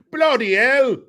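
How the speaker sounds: loud, shrieked-up British exclamation; hammed-up British outburst; mock British accent.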